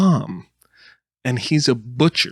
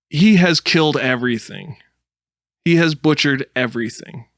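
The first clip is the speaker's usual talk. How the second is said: normal talking voice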